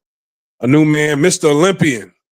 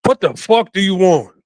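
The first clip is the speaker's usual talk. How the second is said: a curt, higher-pitched daughter's snap, mock-stern; 5 semitones higher than usual. Imitated daughter snapping at her mother on Christmas, mock-stern. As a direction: bark it like a fed-up daughter, half-hammed. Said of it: "a very stern voice".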